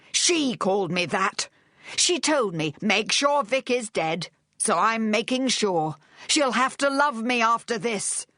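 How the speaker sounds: high, agitated insistence; overwrought rant. A narrator's reading. Charles justifying himself to Vic at gunpoint, overwrought. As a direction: insist wildly, pitched high throughout. Gunman continues his rant.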